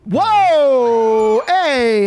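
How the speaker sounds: loud, high, drawn-out whoa; shocked protest. Real, not acted. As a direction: call out to halt a crude joke. Host protests a crude joke.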